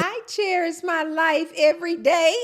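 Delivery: high-pitched delighted shout-out; excited exclamation. Real, not acted. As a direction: sing out the username high with delight. Exclaiming over a username.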